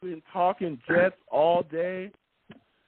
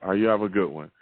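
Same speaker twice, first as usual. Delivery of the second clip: normal talking voice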